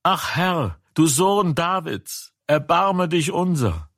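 high pleading call for mercy; crying out. A narrator's reading. Blind men begging Jesus for mercy, high pleading cry. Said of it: "schrien sie und sprachen".

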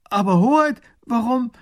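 high, loud cry of dismay; dismayed call. Acted. Minister calls to the grand duke in dismay. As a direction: call out to his lord in alarm. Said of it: "Der erste Minister ruft".

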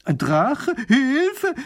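high, loud shriek for help; panicked cry. Acted. Neighbour panics at dragon news.

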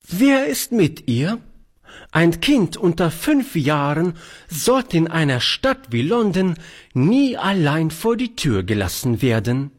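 high, scolding spinster voice; alarmed old lady. Acted. Charlotte scolds about children alone in London, high alarmed voice. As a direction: fret at him like a prim aunt.

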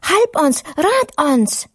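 high, urgent cries to wake him; frantic pleading. Acted. Frightened disciple pleads with sleeping Jesus, frantic. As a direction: beg the sleeping master for help. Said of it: "Abgeregt rannte der Jünger".

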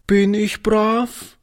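put-on high, slow little-girl question; timid child seeking reassurance, pitched high; anxious. A narrator's reading. Little girl asks the old man if she is good, anxious. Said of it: "fragte sie ängstlich".

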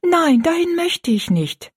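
put-on high, drawn-out girlish protest; pleading refusal. A narrator's reading. Rosalie begs off the workhouse, high protest. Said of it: "sagte Rosalie rasch".

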